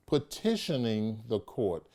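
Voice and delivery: ordinary talk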